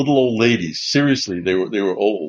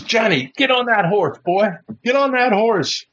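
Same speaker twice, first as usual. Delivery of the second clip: big bellowing guy; 9 semitones higher than usual. Retold or imitated. Big guy ordering Johnny onto the horse, mock bellow. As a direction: imitate a big man ordering someone about. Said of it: "this guy with the big bellowing voice".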